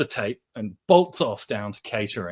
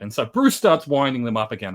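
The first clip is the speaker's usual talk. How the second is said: normal talking voice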